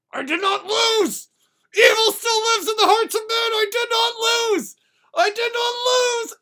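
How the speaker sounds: high, loud, drawn-out wail of defeat; hammed-up raging tantrum; screaming in denial. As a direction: shriek it like a beaten god refusing to accept it. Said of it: "screaming that he won".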